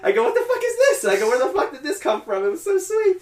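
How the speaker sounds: very high-pitched squealed reaction; stunned disbelief. Retold or imitated. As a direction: squeak it out in comic, overwhelmed astonishment. Retelling own stunned reaction to ending.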